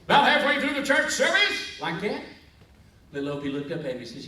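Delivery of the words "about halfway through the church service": high, booming, chanting preacher; hammed-up pulpit wail, loud and drawn out; Reverend Tucker. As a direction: stretch the vowels into a sung sermon. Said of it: "That's the way he thought".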